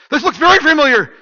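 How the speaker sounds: high, loud cry of dawning recognition; mock alarm. Acting Mike recognising the pastor's street, loud mock alarm.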